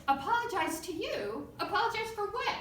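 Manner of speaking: high-pitched indignant retold questions; mock-affronted echo, pitch climbing; incredulous comeback. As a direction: throw the demand back in disbelief.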